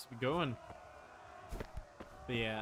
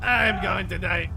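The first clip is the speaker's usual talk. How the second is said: loud, higher panicked yell; panicked shout; 5 semitones higher, 12 dB louder than usual. Real, not acted. Gamer cornered by a monster, shouting in panic. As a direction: cry out as if death is certain.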